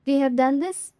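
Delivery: ordinary talk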